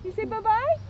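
high, sing-song question. Real, not acted. Officer coaxes child to say bye-bye, high sweet question.

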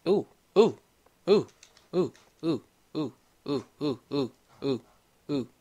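short repeated hoots, falling away; silly hoots. Acted. Gamer hoots to himself while playing, silly short hoots.

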